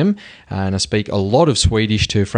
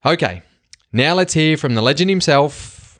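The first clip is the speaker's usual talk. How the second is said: normal talking voice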